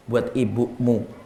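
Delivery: soft, slow, gentle reveal; tender reveal. Acted. Husband tells his wife the gift is for her mother, soft and slow. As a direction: let it land softly, slow and loving.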